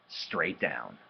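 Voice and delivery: ordinary delivery, nothing marked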